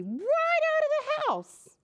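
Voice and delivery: very high, sudden squeal; high squeaky panic; squeaky startled. Acted. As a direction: shoot the voice way up in fright. Spider frightens Humpty away.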